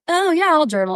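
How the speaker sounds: high, bright fake enthusiasm; mock eager agreement. Client assures the counselor, hollow over-eager yes. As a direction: promise it brightly, not meaning a word.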